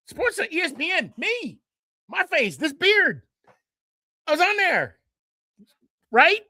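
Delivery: high, excited voice full of pride; bursting with excitement about being on TV; thrilled. Real, not acted. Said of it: "See, even now I get emotional about it".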